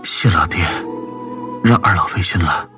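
faint, breathy voice of a wounded man; weak invalid's murmur; weakly. Acted. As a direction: thank them feebly, with little strength. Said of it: "他虚弱地说".

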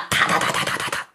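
soft unvoiced clatter of galloping hooves; hoofbeats. Shown as a demonstration. Storyteller mimics Qin's horse galloping after the donkey, whispered clatter. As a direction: patter out the horse's hooves under the breath.

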